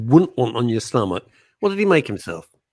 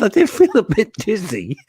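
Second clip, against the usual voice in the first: failed Geordie; 7 semitones higher than usual. Retold or imitated. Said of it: "I can't do the accent, he's Geordie".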